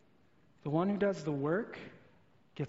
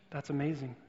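normal talking voice

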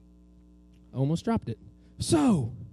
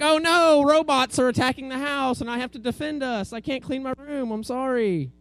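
raised-pitch mock panic; hammed-up alarm, easing into apology; making excuses; 6 semitones higher, 4 dB louder than usual. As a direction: act out a kid's overblown excuse. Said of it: "have you ever made an excuse".

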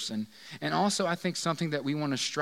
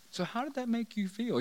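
higher, softer counseling voice; mock counselor; 6 semitones higher, 6 dB quieter than usual. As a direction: ask like a therapist probing gently. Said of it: "Can you imagine this counseling session?".

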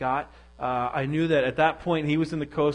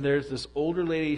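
usual speaking voice

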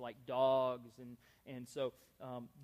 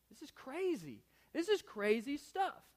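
Cornelius bewildered; 10 semitones higher than usual. Retold or imitated. Voicing Cornelius' doubt.

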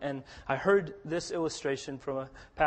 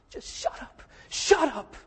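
breathy first, then a high tearful shut up; a touch of tearful heroine; 8 semitones higher than usual. Retold or imitated. Heroine interrupting his speech, tearful and high. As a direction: lightly voice the crying woman.

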